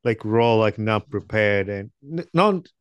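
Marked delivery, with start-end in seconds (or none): none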